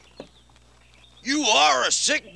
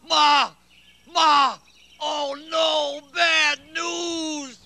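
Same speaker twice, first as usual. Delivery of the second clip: yelling for help; much slower than usual. Acted. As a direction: shout for mother in a panic. Calling mother in alarm.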